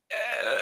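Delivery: long rasping rattle from the chest; wordless rattling noise held long; imitated death rattle. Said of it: "all you heard out of her chest was this".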